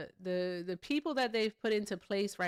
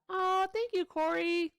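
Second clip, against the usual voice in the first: high, bright burst of thanks to Corey; thanking a donor on stream, high and excited; 12 semitones higher, 4 dB louder than usual. Real, not acted. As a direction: squeal a quick thank-you to Corey. Thanking a viewer for jets.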